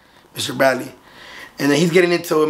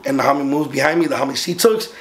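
ordinary talk, nothing marked

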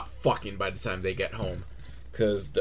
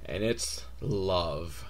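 mock tender reveal; 8 semitones lower, 12 dB quieter, much slower than usual.

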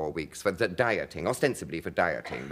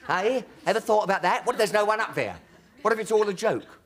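higher, louder, urgently earnest imitation of Hancock; terribly earnest; 13 semitones higher, 5 dB louder than usual. Hancock pressing his friend about God, imitated earnest and loud. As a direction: press the big question on your mate, half laughing. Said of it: "he got terribly earnest".

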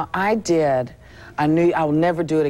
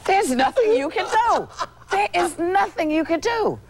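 much higher, mocking heckler voice; heckler impression. Front-row drunk taunting the comic, high mock voice. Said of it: "this guy sitting in front went".

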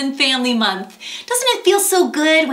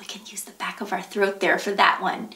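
whisper; 9 semitones lower, 9 dB quieter, much faster than usual. Real, not acted.

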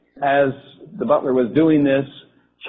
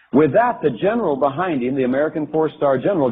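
ordinary delivery

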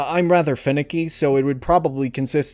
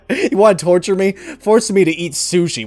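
repeated in a louder, higher mock protest; louder mock outrage; 6 semitones higher, 5 dB louder than usual. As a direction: play up the fake indignation, louder and higher.

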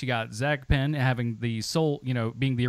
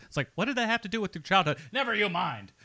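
ordinary talk, nothing marked

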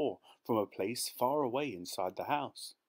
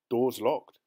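louder, matter-of-fact report; a touch of bluntness; announcing; 3 semitones higher, 7 dB louder than usual. A narrator's reading. Chauffeur returning from the front door, mild announcement. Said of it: "he announced".

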